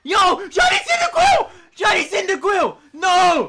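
real stunned excitement; shocked, elated shout. Real, not acted. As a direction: shout in thrilled disbelief. Shock at a shiny appearing.

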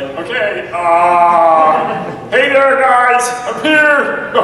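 loud, high, exuberant cartoon voice; Vinny. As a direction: burst in belting a big greeting. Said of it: "And then Vinny's like".

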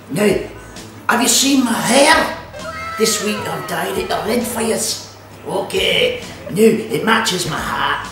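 broad hammed-up Scottish accent all through; comic Scottish accent. Cooking show host chatting to viewers, in a broad comic Scottish accent. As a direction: play a cheeky Scottish baking-show host.

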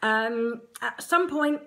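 usual speaking voice

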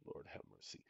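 breathy, exasperated whisper under the breath; whispered aside. Real, not acted. To himself, fed up with viewers, a whispered sigh. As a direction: let it slip out as a tired whisper.

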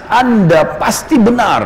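high, slow proclamation; loud declaration. Retold or imitated. Abu Bakar declares his faith to the crowd, high and slow. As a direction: proclaim it slowly for all to hear. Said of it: "Abu Bakar teriak dengan suara keras".

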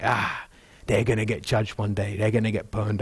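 low, dismissive mock muttering; smug judgmental Pharisee. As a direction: sneer at the crowd as doomed. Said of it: "being like".

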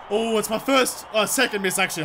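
usual speaking voice

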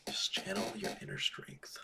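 whispered self pep talk; whispering. Real, not acted. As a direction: whisper it to yourself. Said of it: "self-coaching whisper".

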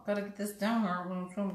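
lips covered by sheet mask; through a face mask. Real, not acted. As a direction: fingers tugging at lips. Seen 2: Sheet mask covers lips while she tugs it; lips freed ~3.6s.

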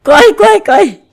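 high, loud urging to run; urgent yelling. Real, not acted. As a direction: shout him into running. Fleeing pigs.

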